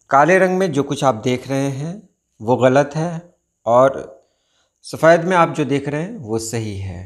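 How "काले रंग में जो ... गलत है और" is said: usual speaking voice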